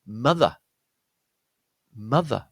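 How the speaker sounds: "mother" with stressed short uh, then schwa. Shown as a demonstration. Teacher giving another example word, "mother" with stressed uh. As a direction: say "mother" carefully, uh as in cut, falling. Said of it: "the O is an uh sound".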